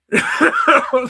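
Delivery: a sudden high laugh breaking out; burst of laughter. Real, not acted. Laughing while telling nephew's wrestler story. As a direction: laugh out loud at the absurdity.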